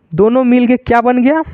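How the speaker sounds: ordinary talk, nothing marked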